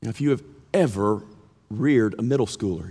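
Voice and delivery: usual speaking voice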